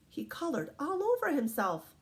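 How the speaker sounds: plain reading voice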